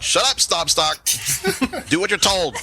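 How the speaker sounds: high-pitched goblin telling off an underling; comic high goblin rebuke; scolding goblin. Acted. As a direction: put the big brute in his place, high-voiced. Goblin scolds the big one.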